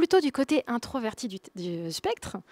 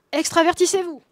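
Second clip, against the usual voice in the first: louder emphatic repeat; 5 semitones higher, 7 dB louder than usual. Shown as a demonstration. Book title repeated, escalating.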